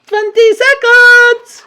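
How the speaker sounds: very high, slow countdown screech; witch crying out. A narrator's reading. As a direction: call out the time like a shrieking witch. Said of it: "cried the Grand High Witch".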